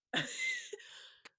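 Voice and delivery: high-pitched rueful laugh. Real, not acted. Laugh after painful story.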